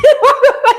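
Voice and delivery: a loud, high burst of laughter. Real, not acted. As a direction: crack up loudly at the guest's answer. Host laughs at answer.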